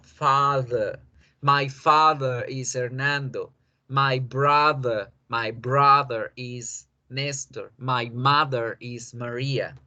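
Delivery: slow, careful My father is Hernando with a schwa in father; slow model sentences. Shown as a demonstration. Teacher reading model sentences slowly to students. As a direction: spell out each word slowly for the class.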